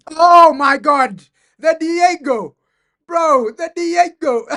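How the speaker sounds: screaming in ecstatic disbelief; high, loud shriek of joy; shrieking jackpot winner. Real, not acted. Goal scored, fan erupts.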